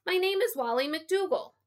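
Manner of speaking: put-on high-pitched insistent boy; shouting. A narrator's reading. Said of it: "All the shouting caused the tired old man".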